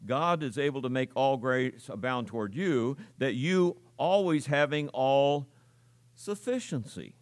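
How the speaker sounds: verse read to spotlight "sufficiency"; stress on sufficiency. Shown as a demonstration. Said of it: "I want you to notice the word sufficiency".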